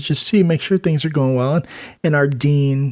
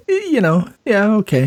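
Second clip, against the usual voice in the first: flustered; 7 semitones higher than usual. Retold or imitated. Flustered reply to the boss.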